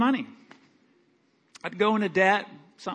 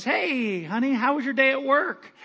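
higher, light housewife greeting; cheery wife; 7 semitones higher than usual. Pretend. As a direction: sweetly greet husband home. Wife greets pig farmer.

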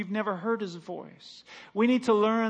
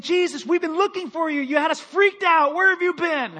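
frantic, higher parent's plea; worried parents; 8 semitones higher, 5 dB louder than usual. Retold or imitated. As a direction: sound like a panicked mother finding her boy. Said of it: "they came to him and they said".

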